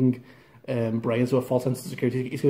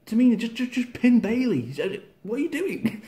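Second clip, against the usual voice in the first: exasperated; 9 semitones higher than usual. Real, not acted. Fan yelling at Tamina to pin Bayley, higher and exasperated. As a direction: plead with the wrestler to just pin her.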